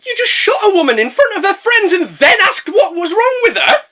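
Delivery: very high, loud incredulous yell; outraged laughing shout. Acted. Narrator yelling at the police lady, laughing. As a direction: scream at her in disbelief.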